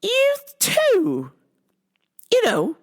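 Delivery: very high sugary mock voice; mocking the lady. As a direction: sneer it in a fake sweet voice. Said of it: "And she said".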